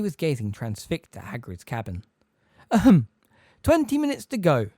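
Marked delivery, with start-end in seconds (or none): 2.7-4.7 high, loud little cough and prim reminder; an elderly professor clearing his throat at the students; fussy old examiner. A narrator's reading. Examiner calls the time to students, high and prim. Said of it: "said Professor Tofty".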